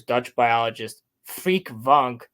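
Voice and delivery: normal talking voice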